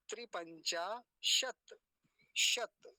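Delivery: slow त्रिपंचा, शत ending on bare t; halant demo. Shown as a demonstration. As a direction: close शत sharply with no final vowel. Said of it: "फिर तकार हलंत".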